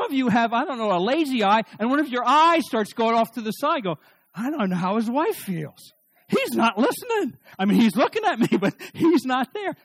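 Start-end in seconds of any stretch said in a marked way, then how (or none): 4.3-9.7 high, loud mock complaint; exasperated wife. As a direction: imitate a wife venting in exasperation. Mimics a wife complaining about husband.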